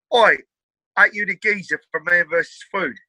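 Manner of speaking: imitated Essex geezer calling out; Essex accent. Stranger at a house party spotting a TV face, Essex accent. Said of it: "that's a great accent".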